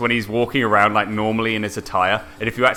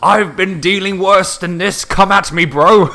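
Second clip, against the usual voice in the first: higher and louder, slow cocky challenge; mock tough butler; 9 semitones higher, 6 dB louder, slower than usual. Butler Winston taunting Lara after being shot, cocky mock bravado. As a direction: taunt the shooter like a swaggering brawler. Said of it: "Winston's just like".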